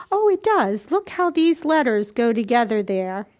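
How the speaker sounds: usual speaking voice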